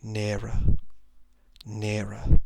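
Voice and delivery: ordinary delivery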